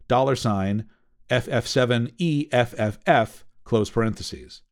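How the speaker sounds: slow, spelled-out code dictation; dictating. Shown as a demonstration. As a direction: read out each symbol slowly and clearly. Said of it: "A command like this".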